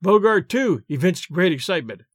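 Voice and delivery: ordinary delivery, nothing marked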